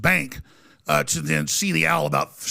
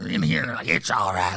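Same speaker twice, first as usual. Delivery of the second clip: put-on mumbled low, drawn-out old man grumble; low mock old-timer voice; 5 semitones lower, 5 dB quieter than usual. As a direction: imitate a slow, muttering old man.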